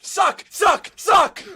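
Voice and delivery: high mocking chant; taunting shout. Real, not acted. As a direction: jeer it out, high and gleeful. Taunting the Jets.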